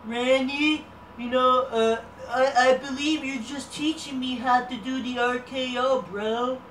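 a hint of a laid-back bro voice; mild stoner-bro imitation; imitating Riddle. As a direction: lightly voice a chill surfer bro. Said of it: "Then Riddle interrupts us".

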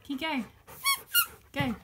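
ordinary talk

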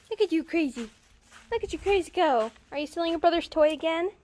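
high, loud doting dog-talk; fussing over a crazy puppy; playful puppy talk. Real, not acted. Playfully talking to her puppy.

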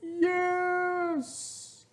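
high eager yes; class cheering. Acted. Class answering teacher, eager yes. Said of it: "the class yelled".

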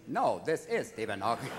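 low computer voice, insisting on IS; Stephen Hawking. Acted. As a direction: correct the caller in a synthesizer voice. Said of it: "I once called Stephen Hawking's house".

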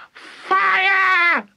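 very high screamed alarm; yelling. A narrator's reading. Grandma raising the alarm, yelling. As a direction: scream it like an old woman on fire. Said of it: "the old woman yelled".

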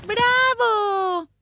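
high, loud, sustained cheer; elated girl's cry; cheering shout. A narrator's reading. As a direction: applaud with the voice. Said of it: "gritó Annie aplaudiendo".

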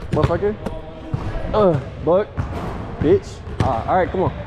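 normal talking voice

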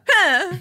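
high, loud "huh" call; a sudden high honk of a noise; weird noise. Retold or imitated. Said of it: "just making weird noises".

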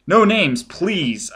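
high, loud startled protest; shocked. A narrator's reading. Crewman cutting in on the teasing, shocked and loud. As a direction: cut in, scandalised. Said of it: "a shocked voice interrupted".

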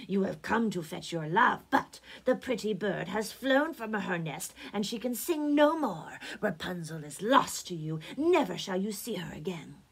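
scornful taunt in a put-on witch voice; mocking witch. A narrator's reading. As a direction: gloat cruelly as the witch. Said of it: "she cried mockingly".

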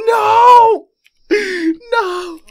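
loud, high, wailing cries; despairing shout. Real, not acted. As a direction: howl in helpless frustration. Cannot save the items.

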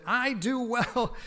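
angry prophet talking back to God, loud and slow; defiant Jonah. Acted. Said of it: "And he said".

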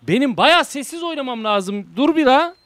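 high, loud and laughing; loud laughing protest. Real, not acted. Streamer laughing loudly that he didn't scream.

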